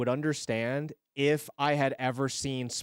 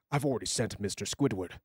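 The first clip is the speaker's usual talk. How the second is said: low character voice; 6 semitones lower, 5 dB quieter than usual. Pretend. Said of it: "Do the live dub".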